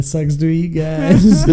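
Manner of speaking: slow, laughing jab at rival fans; giggling mock insult to Astros fans; laughing taunt. Real, not acted. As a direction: tease them through a laugh. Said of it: "glancing blows you'd like to throw".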